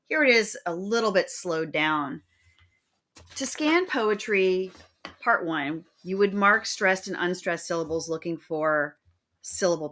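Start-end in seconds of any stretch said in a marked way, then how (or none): none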